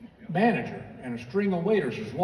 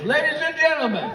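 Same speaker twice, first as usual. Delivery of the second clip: higher, louder showman announcement to the room; restaurant announcer; 6 semitones higher, 4 dB louder than usual. Retold or imitated. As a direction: play the manager hamming it up for the whole restaurant. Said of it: "turns to the entire restaurant and goes".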